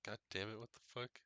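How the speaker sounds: mildly freaked-out exclamation; mock freaked out. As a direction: imitate a startled, rattled reaction. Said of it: "freaked out".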